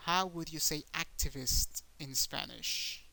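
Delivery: normal talking voice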